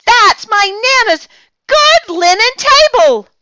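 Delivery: put-on very high, loud outraged girl; girl scolding her friend about the tablecloth; scandalized shriek. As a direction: shriek at the stain.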